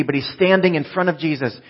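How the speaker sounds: usual speaking voice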